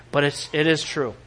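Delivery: ordinary delivery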